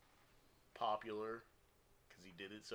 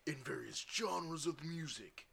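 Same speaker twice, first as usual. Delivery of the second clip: movie trailer voice; 3 semitones higher, 8 dB louder than usual. Shown as a demonstration. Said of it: "movie trailer voice?".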